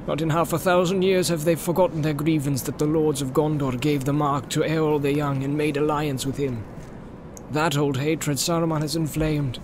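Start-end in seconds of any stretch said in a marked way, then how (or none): none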